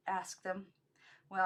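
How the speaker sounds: plain reading voice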